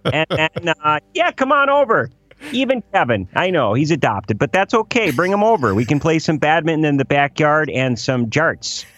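playful Wisconsin neighbor inviting everyone over; Wisconsin accent. Retold or imitated. Host invites the neighbors to a backyard party, light Wisconsin accent. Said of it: "coming from a Wisconsin accent".

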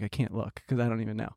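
low, hushed retold thought; soft, low aside to yourself; inner thought. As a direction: drop your voice as if thinking privately. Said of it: "But in my head, I was like".

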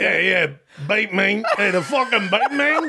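Long Island regular giving his opinion; Long Island accent. Acted. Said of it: "that's a Long Island accent".

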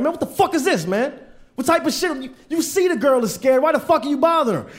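high-pitched retold outrage; mad. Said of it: "I was mad".

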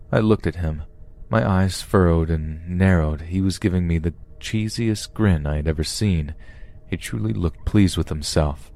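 ordinary delivery

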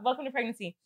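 normal talking voice

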